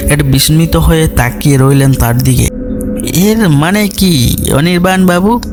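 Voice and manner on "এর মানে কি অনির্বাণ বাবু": high, slow, wondering question; questioning colonel. Acted. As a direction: ask it slowly, pitched up, rising at the end. (কৌতূহলী কর্নেলের চড়া গলায় প্রশ্ন)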